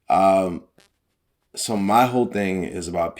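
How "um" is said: ordinary talk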